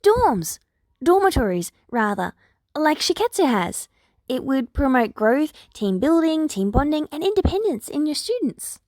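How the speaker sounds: a lightly voiced eager headmaster; excited principal thinking aloud. A narrator's reading. Nedzu voiced pitching dorm idea.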